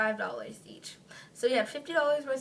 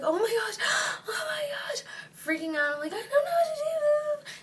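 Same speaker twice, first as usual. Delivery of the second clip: high, breathy tearful panic; screaming, freaking out. Retold or imitated. Teller reliving her panic after the injury, screaming and freaking out. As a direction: re-enact a panicked cry, voice shooting way up. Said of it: "I'm screaming ... freaking out".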